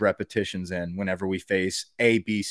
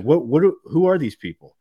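loud, higher, baffled what-are-we-doing outburst; mock disbelief; 5 semitones higher, 7 dB louder, faster than usual. Fan baffled by walk-ons on special teams, loud incredulous outburst. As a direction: throw up your hands in disbelief. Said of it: "I'm just like".

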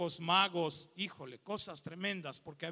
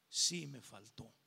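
much lower, soft and slow, near tears; tearful admission; 8 semitones lower, 6 dB quieter, slower than usual. Retold or imitated. Pastor admitting to God he missed his father, tearful. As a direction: confess it with a breaking heart. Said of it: "Y yo le contesté".

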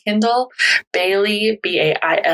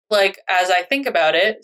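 ordinary talk, nothing marked

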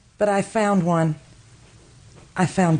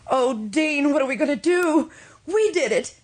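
higher, pleading and frightened; rising panic; 5 semitones higher than usual. Acted. Wife begs her husband what to do, panicking. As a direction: climb into panic as you beg him.

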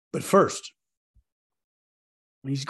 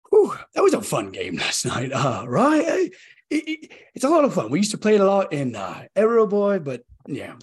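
nervous, rushed and high-pitched; babbling nervously, words tumbling out; 6 semitones higher, 5 dB louder than usual. Acted. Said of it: "Sorry, I'm a, I'm a bit nervous".

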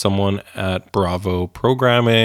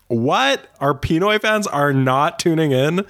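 higher, shocked disbelief; surprised; 7 semitones higher than usual. Real, not acted. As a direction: react in stunned disbelief. Shocked at missing listeners.

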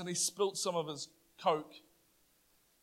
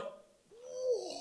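much higher, breathy gasp of alarm; taken aback; mock surprise; startled whoa; 5 dB quieter than usual. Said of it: "I was just like".

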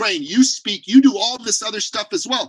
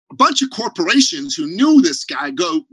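ordinary talk, nothing marked

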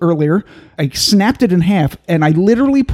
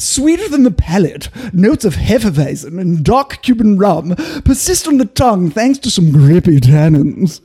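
hammed-up British accent, lilting. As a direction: play it uptight British. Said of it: "a British accent".

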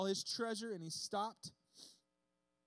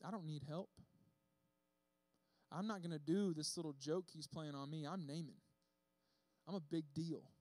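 proud inner voice; 3 semitones lower, 5 dB quieter than usual. Retold or imitated. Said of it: "Naaman thought".

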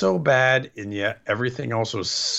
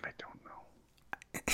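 breathy, slow whisper; 20 dB quieter, much slower than usual. Real, not acted. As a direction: give up quietly. Deflated reply to nonsense plot.

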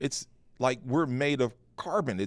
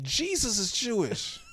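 earnest revelation; 13 semitones higher than usual. Retold or imitated. Explains to wife.

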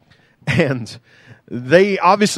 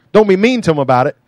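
ordinary talk, nothing marked

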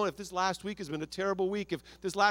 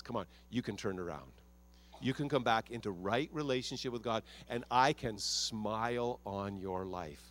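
God's voice; 6 semitones lower, 6 dB quieter than usual. Retold or imitated. Pastor voicing God inviting the one who blew it back, low and soft. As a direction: lower and much softer, a tender inviting God. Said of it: "he says".

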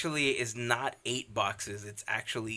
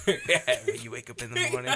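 words breaking into laughter; giggling while talking, voice up high; laughing; 3 dB louder than usual. Real, not acted. Laughing through a shared joke.

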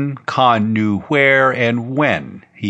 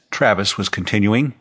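ordinary delivery, nothing marked

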